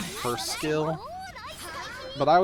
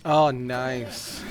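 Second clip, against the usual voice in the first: excited; 8 dB louder than usual. Real, not acted. Good hit in the game.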